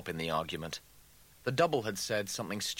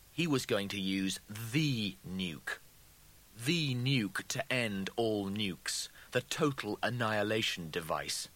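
plain reading voice

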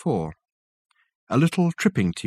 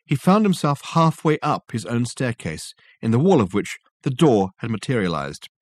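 usual speaking voice

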